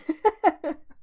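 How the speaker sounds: sudden high giggle breaking through; can't hold back a laugh mid-read; embarrassed laugh escaping; real burst of laughter. Real, not acted. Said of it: "I'm just laughing".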